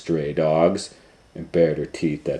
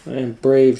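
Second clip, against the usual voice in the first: louder, higher forced bravado; convincing herself; 7 semitones higher, 6 dB louder than usual. Acted. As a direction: talk herself into courage. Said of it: "tried to convince herself".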